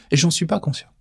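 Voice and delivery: usual speaking voice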